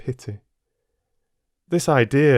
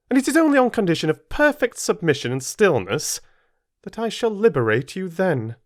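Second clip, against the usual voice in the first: raised, cold, lecturing mistress; stern aunt; 7 semitones higher than usual. Acted. Mrs Reed sentences Jane to stay locked in, cold and stern. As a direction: punish the child with icy authority.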